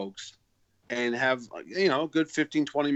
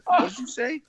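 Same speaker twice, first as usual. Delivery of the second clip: high, louder retort of disbelief; retold affronted question, pitched way up; offended; 11 semitones higher, 5 dB louder than usual. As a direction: act out being taken aback by an insult. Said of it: "getting offended".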